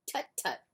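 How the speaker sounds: high little tut, tut; disapproving tuts. Acted. Tortoise scolding Duck with tuts. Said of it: "tutted Tortoise".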